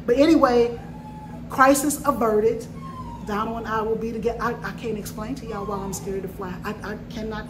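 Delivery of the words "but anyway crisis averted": loud, high relieved declaration; loud relieved crisis averted; loud relief. Real, not acted. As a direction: announce it triumphantly. Relieved announcement.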